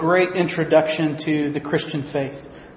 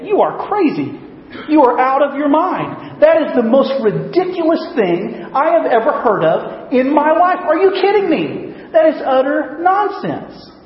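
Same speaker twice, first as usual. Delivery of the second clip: incredulous scoffing; 9 semitones higher than usual. Pretend. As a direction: scoff at it like it's absurd nonsense. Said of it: "I would say".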